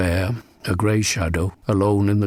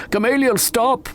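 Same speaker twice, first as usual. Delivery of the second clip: crying out. Acted. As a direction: shout it against the wind. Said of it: "I cried".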